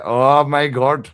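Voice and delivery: "Oh my God." drawn out loud and slow, mocking. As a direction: ham up "Oh my God." in a put-on accent. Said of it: "because I'm going".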